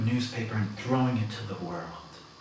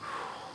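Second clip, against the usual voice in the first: deep exhale; 11 dB quieter than usual. Real, not acted. Breathing exercise.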